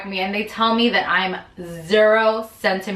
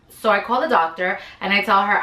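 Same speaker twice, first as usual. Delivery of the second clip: usual speaking voice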